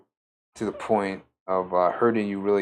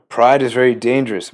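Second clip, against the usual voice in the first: louder, pride stressed hard; forceful warning; 12 dB louder than usual. Real, not acted. Preacher warning listeners about pride, forceful and loud.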